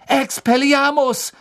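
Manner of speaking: high, loud, drawn-out cry; ringing spell-casting yell; shouted spell. A narrator's reading. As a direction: call out the spell at full voice. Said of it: "rief er".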